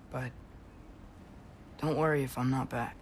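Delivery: ordinary delivery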